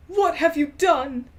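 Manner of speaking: slow, loud booming demand; hammed-up angry old wizard shout; angry headmaster. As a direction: confront her with fury. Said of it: "Dumbledore walked in angrily".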